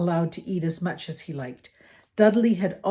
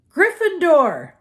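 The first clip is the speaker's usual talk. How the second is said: slow, loud, higher proclamation; hat's shout; 11 semitones higher, 11 dB louder, slower than usual. A narrator's reading. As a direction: shout the house name to the whole hall. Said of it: "heard the hat shout the last word".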